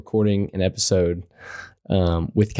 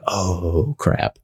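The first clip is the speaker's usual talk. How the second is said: long drawn-out, lower "oh", then "crap"; mock dismay; 5 semitones lower than usual. Player retelling getting quiz answers wrong, mock dismay. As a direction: let a retold dawning realization sink in on a stretched "oh".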